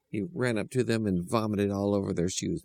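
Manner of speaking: usual speaking voice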